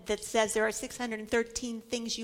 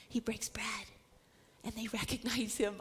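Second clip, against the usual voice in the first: whisper; 3 semitones lower, 6 dB quieter than usual. Real, not acted. Hushed moment in the upper room.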